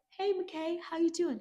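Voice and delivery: high-pitched put-on chummy voice; squeaky imitation of small talk; mock friendly greeting. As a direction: caricature a chirpy hello, way up high. Acts out Cal greeting McKay.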